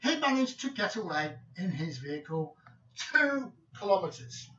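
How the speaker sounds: ordinary talk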